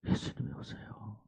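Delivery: hushed whisper. Real, not acted. Pastor telling Jesus came, soft whisper. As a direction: breathe it softly.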